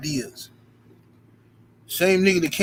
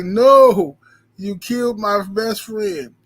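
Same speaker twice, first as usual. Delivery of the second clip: mock anguish; 4 semitones higher, 8 dB louder, much slower than usual. Mock grief over broken toy.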